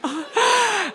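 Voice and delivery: big gasp into a high "oh"; excited gasp. Retold or imitated. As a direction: gasp with thrilled amazement. Acting out excitement at private jet.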